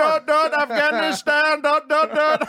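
very high, loud mock Indian accent; hammed-up, shouted bad Indian accent; suburban fake Indian accent. Comic mocking suburbanites doing the voice, high loud fake Indian accent. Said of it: "But in the suburbs, it's all like".

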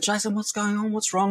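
high-pitched, retold worried questions; concerned asking. Youth worker to boy who burst into tears, concerned asking. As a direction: ask with concern, voice lifted high.

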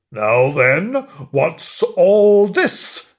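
loud policeman, slow and drawn out at the end; storybook bobby's challenge; village policeman. Acted. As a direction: step in with ponderous authority. Village policeman arrives to investigate.

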